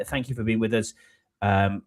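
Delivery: ordinary talk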